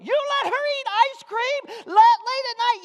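mock incredulous high shriek; imitating a loud, scandalized parent; shocked mother. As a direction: yell it high in disbelief. Voices the mother's shocked question.

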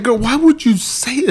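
high, loud mock indignation; mock hurt. Husband mimics himself hurt by wife's remark. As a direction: plead it up high, wounded.

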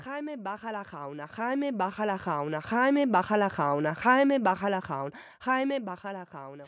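"Jaime baja la jauna" with each J as a throaty Spanish h; repeated tongue-twister on the Spanish J sound. Shown as a demonstration. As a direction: drill the raspy jota in "Jaime", "baja" and "jauna". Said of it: "the J".

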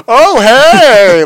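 loud, high, starstruck mock squeal; overexcited greeting. Man retelling meeting the hall's owner, hammed-up excited greeting. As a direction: greet him like he's a huge deal. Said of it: "as if it was a big deal for some reason".

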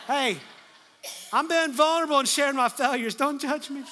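loud, high, playful indignation; mock-defensive protest. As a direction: protest over the laughter, pretending to be hurt. Joking protest at congregation's laughter.

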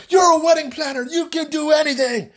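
high-pitched mock bridezilla outburst; hysterical demanding. Bride demanding the impossible from her wedding planner, hysterical high demand. Retold bride screaming back.

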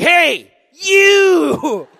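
high, loud, drawn-out holler; angry call-out. Retold or imitated. Mad shopper hailing the crying girl, hammed-up angry shout. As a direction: bellow it grudgingly at a stranger. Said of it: "I was so mad".

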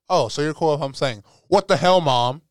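daring her, playful and pushing it, voice up; cheeky loud quote. Retold or imitated. Kid teasing mom about saying hell, loud cheeky challenge. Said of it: "I was like".

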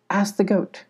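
usual speaking voice

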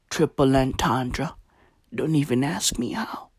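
quick whispered aside. Real, not acted. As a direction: drop to a whisper.

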